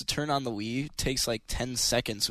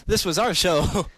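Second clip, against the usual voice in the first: mock possessive exec; 5 semitones higher, 5 dB louder, slower than usual. Voicing Fox taking show back.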